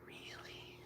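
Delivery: tiny whispered question; hushed, touched reaction; whispered disbelief. Retold or imitated. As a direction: barely breathe it out. Retold reply to tender offer.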